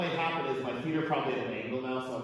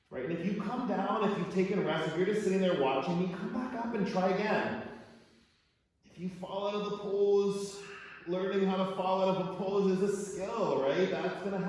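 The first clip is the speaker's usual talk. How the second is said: louder and higher, pushing words out while inverted; strained, upside down; 8 semitones higher, 4 dB louder than usual. Real, not acted. Teacher cueing her class while strained in a headstand. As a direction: talk through the effort of holding a headstand.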